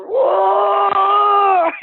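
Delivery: high, loud drawn-out mock howl; imitated Bigfoot scream. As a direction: mimic the furious creature screaming at the light. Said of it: "He kept screaming".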